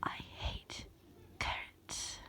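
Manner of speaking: breathy unvoiced whisper right at the ear. Masky whispering into your ear about carrots. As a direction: lean in close and whisper it, teasing. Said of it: "whispers in your ear".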